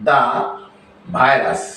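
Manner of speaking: "the" pronounced da before "virus". Shown as a demonstration. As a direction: say "the virus" as da virus, with a gap. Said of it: "অন্য সকল ক্ষেত্রে তার উচ্চারণ হবে".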